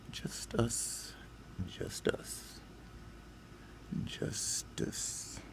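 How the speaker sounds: hushed, fading whisper; whispered bitter refrain; whispered repetition. Real, not acted. Bitter point, repeated softly.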